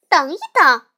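little sister shouting to stop her friend; loud protest. Acted. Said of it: "小熊妹妹抗议道".

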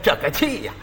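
normal talking voice

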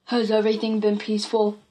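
lightly voiced story father; a hint of a father's voice. A narrator's reading. Father's voice questions daughter.

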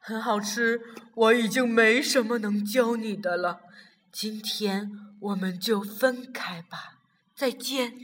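put-on father leaving his child, quietly sad; sad farewell. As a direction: say goodbye holding back sorrow. Father says goodbye at night.

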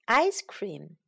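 usual speaking voice

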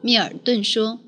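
ordinary delivery

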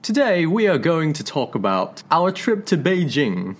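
slow practised British accent; careful British accent, slow and measured; plummy voice. Shown as a demonstration. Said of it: "我现在还在，还在继续练习当中". (慢速英式口音)

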